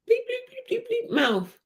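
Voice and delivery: high imitated censor beeps; bleep censor. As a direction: bleep out the swear like TV. Censoring the swear word vocally.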